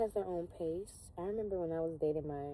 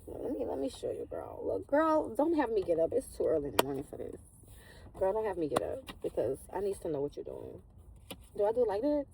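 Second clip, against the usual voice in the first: put-on sassy girlfriend; 3 semitones higher, 6 dB louder than usual. Sassy friend calling out a girl at dawn, loud sass. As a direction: put some attitude on it, girl.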